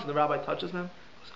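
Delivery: usual speaking voice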